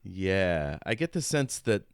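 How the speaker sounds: ordinary talk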